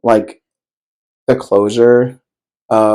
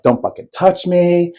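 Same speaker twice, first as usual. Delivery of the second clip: livid retort; 7 semitones higher than usual. Retold or imitated. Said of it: "I was just livid".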